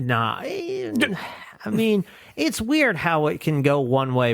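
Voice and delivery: usual speaking voice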